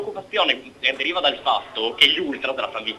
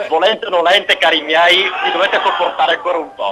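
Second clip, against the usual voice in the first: playful loud insistence; 5 semitones higher, 7 dB louder than usual. Real, not acted. Insists on staying on air.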